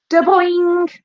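very high, loud "da-boing"; sharp rise in pitch on the bounce; imitated ball bounce. A narrator's reading. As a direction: make a springy cartoon bounce.

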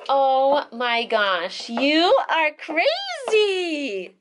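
slow, stretched, high amazement; amazed exclamation. Real, not acted. Baby broke lock.

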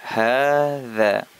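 teacher's clear model of hadza; careful Arabic hadza. Shown as a demonstration. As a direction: model hadza slowly for students, ha-dha. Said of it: "Sekali lagi".

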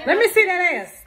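loud teasing shout; loud hype call. Acted. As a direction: hype her up loudly.

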